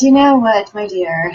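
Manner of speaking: a hint of Irish lilt, friendly and teasing; mild Irish brogue of a kindly doorman; Irish accent. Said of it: "with his little Irish accent".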